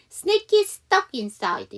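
"스낵 is stuck inside" linked as "sna-kis-tuck inside"; linked sounds. Shown as a demonstration. Teacher demonstrates linking, "스낵 is" joined and the extra S dropped. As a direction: run "스낵 is stuck" together with one S. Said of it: "연음시키면 스낵 키즈인데".

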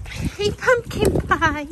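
high, slow sing-song coo. Real, not acted. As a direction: coo sweetly to a pet. Cooing at her pets.

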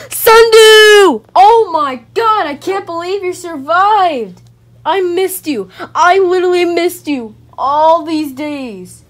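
loud, high, emotional cries; overjoyed reunion. Real, not acted. Gamer finding Sundew survived, overjoyed. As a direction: cry out to a long-missed companion.

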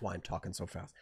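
a real whispered aside. Real, not acted. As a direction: lean in and whisper it like a secret. Confides why he talks fast.

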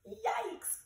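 high startled cry; startled yelp. A narrator's reading. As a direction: yelp in surprise. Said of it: "cried Joey Mackerel".

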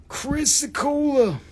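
"Chrysocolla" drawn out slowly and pitched high; sounded-out name. Shown as a demonstration. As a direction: spell the word out with the voice.

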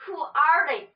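loud demanding queen; shouted. A narrator's reading. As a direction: bark the question like a haughty queen. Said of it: "Queen Tut shouted".

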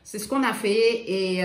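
usual speaking voice

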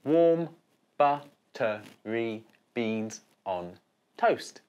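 slow, each syllable of buttery beans on toast spaced out; counting syllables. Shown as a demonstration. Teacher counting seven syllables for children, slowly. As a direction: beat out the seven syllables one by one. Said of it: "the number of syllables in the second line".

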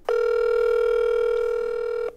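faint, voiceless tail of laughter; wheezing laugh. Real, not acted. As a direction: laugh until no voice is left. Laughs after the prank.

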